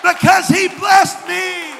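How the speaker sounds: rising, shouted preaching with long held phrases; full-force preaching. Acted. Pastor praising God to the congregation, shouting preaching. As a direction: preach it out loud to lift the church.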